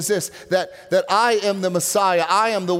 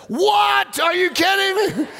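shocked outburst; 12 semitones higher, slower than usual. Retold or imitated. Preacher retelling his stunned reaction to a healing, shouting in disbelief. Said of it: "I was like".

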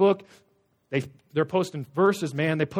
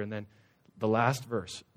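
ordinary talk

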